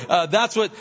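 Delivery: ordinary talk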